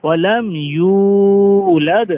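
slow, drawn-out chanted recitation; slow tajweed recitation of আল্লাহু সমাদ; Quran recitation. Shown as a demonstration. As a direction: recite it slowly with full tajweed. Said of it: "উচ্চারণে লাদ".

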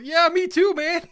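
high, loud squeal of dread; scared squeal agreeing with the bad feeling; frightened yelp. Real, not acted. Dread before the fort.

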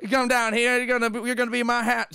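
Southern voice. Retold or imitated. Gamer reading Bowser lines in a light Southern accent. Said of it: "I don't know why I'm going southern".

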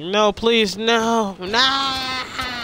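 high, loud begging that builds to a scream; beg, then shriek in pretend terror; hammed-up death cry; mock pleading scream. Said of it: "like that".

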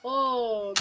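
ordinary talk, nothing marked

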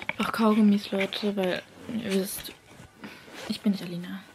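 whispered teasing aside. Real, not acted. Vlogger whispering about the chewing gum. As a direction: whisper it cheekily.

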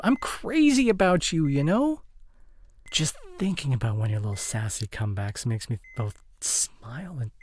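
ordinary talk, nothing marked

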